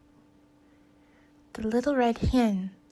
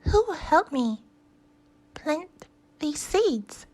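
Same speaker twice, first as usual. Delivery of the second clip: hen voice; 6 semitones higher than usual. Acted. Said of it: "she asked".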